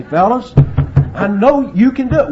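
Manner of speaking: high, loud rallying coach; coach's pep talk. Acted. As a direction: rouse a losing team at halftime. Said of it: "and you say".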